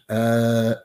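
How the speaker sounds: long, droning uhs, evenly spaced; mocking hesitation fillers. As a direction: imitate a speaker stuck on filler sounds. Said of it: "Stop with the ums, the uhs".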